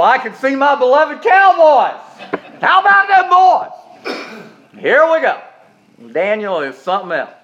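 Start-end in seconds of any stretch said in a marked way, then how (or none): 0.0-5.4 high, slow, laughing fan call; teasing fan shout; mock cowboys cheer. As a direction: ham up the rival team's cheer.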